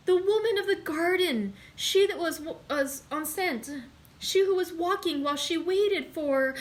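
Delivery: high, loud, broken recollection; breaking off in dread; terrified. A narrator's reading. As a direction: servant gasping out the secret of the garden. Servant recalling the garden, terrified.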